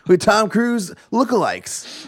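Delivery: high, slow ad voice; hype announcer. Pretend. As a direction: stretch it like a cheesy ad. Mock ad continues.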